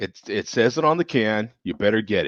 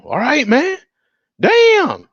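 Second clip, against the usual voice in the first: high startled backing off; 11 semitones higher, 5 dB louder, slower than usual. Retold or imitated. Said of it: "drinker backing off".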